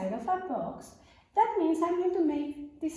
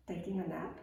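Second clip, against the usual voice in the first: sleepy hush; 8 semitones lower, 8 dB quieter than usual. Acted. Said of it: "character sleeping in story".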